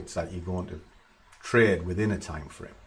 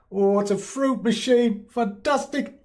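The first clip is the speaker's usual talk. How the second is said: much higher, louder, hammed-up childish glee; mock delight; 14 semitones higher, 4 dB louder than usual. Mocking a gambler thrilled by a slot machine, hammed-up glee. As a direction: squeal like a kid at a fruit machine.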